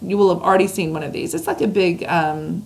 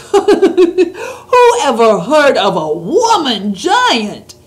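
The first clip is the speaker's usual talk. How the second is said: loud, higher, slow giant shout; 6 semitones higher, 7 dB louder, much slower than usual. A narrator's reading. Giant shouting at Sophie, waving snozcumber, loud. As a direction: bellow it while swinging the snozcumber. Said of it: "shouted the B F G".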